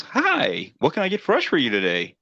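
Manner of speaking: high, bright mock greeting, sing-song; cheery drive-thru voice. First drive-thru voice greets customer, high fake cheer. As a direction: play the fake-friendly first voice. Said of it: "and one voice goes".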